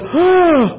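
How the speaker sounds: sharp high gasp of surprise; preacher imitating the crowd's startled gasp. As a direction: gasp as if the news shocks you. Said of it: "And everyone goes".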